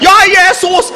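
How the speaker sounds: high, loud mock praying cry; fervent prayer. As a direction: call out to Jesus in exaggerated fervour. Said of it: "Saya itu sampai mendoakan". (menirukan seruan doa yang berapi-api)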